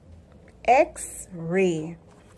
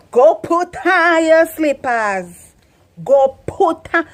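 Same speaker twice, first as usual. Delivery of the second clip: loud, higher Patois order, slow; Jamaican parent command; 6 semitones higher, 6 dB louder, slower than usual. Shown as a demonstration. Jamaican mother ordering her child, loud Patois. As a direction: bark it like a Jamaican mum. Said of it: "we full of expression".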